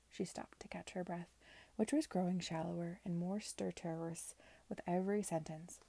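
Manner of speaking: ordinary delivery, nothing marked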